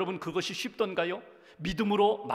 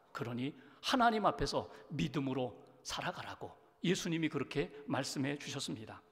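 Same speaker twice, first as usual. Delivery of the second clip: normal talking voice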